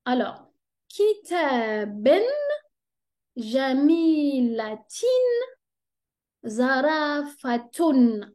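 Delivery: kitaben, jamilatin, zarafatun each spelled out slowly; slow Arabic examples. Shown as a demonstration. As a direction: read the examples syllable by syllable.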